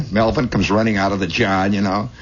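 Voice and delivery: normal talking voice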